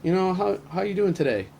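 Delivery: high, bright friendly greeting; friendly phone greeting. Retold or imitated. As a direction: greet her brightly and cheerfully. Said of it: "and I was like".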